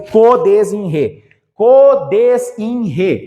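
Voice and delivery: CODESINRE pulled apart very slowly, syllable by syllable; drawn-out mnemonic. Shown as a demonstration. Teacher drilling the rule into students, drawn-out syllables. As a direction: stretch every part of "codesinre".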